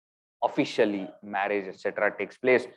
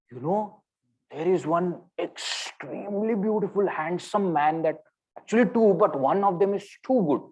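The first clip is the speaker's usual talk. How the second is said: lightly voiced excited young girl, higher; thrilled girlfriend; 7 semitones higher than usual. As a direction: gush about a handsome stranger. Thrilled friend voiced in story.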